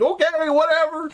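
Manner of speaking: high shrugging whatever; dismissive guard. Acted. Guard brushing off the rangers, high dismissive. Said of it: "they're like".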